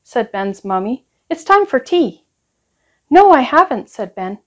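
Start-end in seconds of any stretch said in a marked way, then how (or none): 1.3-2.2 bright, higher motherly question; higher-pitched mum asking; mummy's voice. A narrator's reading. Mother calling for her child. 3.1-3.9 put-on higher, louder child's reply; higher child's quick no; little boy voice. A narrator's reading. Boy Ben answers his mum.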